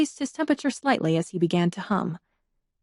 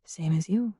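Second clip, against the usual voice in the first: soft low murmur, dropping away; 3 semitones lower, 6 dB quieter than usual. A narrator's reading. Harlequin quietly answering the stranger, murmured. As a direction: murmur it almost to herself. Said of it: "she murmured".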